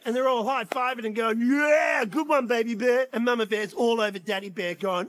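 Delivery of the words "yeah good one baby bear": louder, drawn-out cheer; mock celebratory whoop; cheering bears. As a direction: cheer the hero of the hour. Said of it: "The bears cheer".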